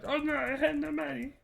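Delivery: very high squeaky victim voice; mock-frightened falsetto; panicked victim. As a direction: whimper it like a cornered newbie. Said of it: "they would be like".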